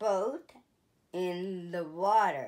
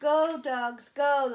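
high, loud cheer; cheering them on; 6 semitones higher, 6 dB louder than usual. A narrator's reading. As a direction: urge the dogs forward loudly. Cheering dogs on at green.

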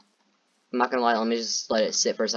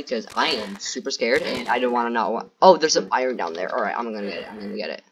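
ordinary delivery

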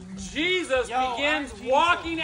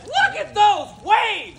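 high, loud, slow cry; alarmed shout; 6 semitones higher, 6 dB louder than usual. A narrator's reading. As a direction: shout a warning across the boat. Acted alarm at the waves.

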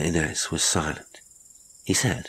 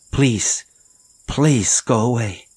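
higher and louder, slow; desperate plea; 6 semitones higher, 6 dB louder, slower than usual. Acted. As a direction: beg him to go with all her strength. Inez begs him.

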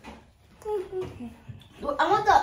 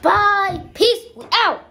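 loud sign-off; 8 dB louder, slower than usual. Real, not acted.

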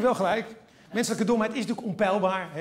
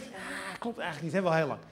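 soft, low murmur of doubt; little inner voice; 3 semitones lower, 6 dB quieter than usual. Acted. Little voice in everyone's head, soft doubting. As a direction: say it small, like a nagging conscience. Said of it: "dat stemmetje die zegt".

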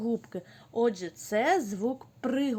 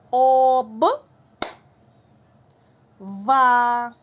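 syllables called out loud and held, each alone; sustained loud teacher calling of syllables; loud syllable dictation; 3 semitones higher, 10 dB louder, much slower than usual. Shown as a demonstration. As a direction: project each syllable to the whole class. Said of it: "Слухайте уважно".

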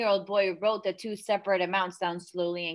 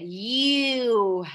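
high, loud, slow furious shout; father accusing his daughter of cheating, shouting; sudden shout; 6 semitones higher, 10 dB louder, much slower than usual. Acted. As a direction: explode at her, dragging it out. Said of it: "her father suddenly shouting".

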